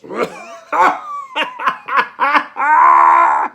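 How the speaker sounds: loud villain's cackle; long, loud burst of scornful laughter; mocking laughter. Priest jeering at the dying Jesus, big gloating laugh. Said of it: "Caiaphas laughs".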